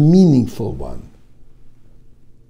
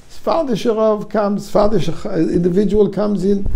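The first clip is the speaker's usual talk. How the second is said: ordinary talk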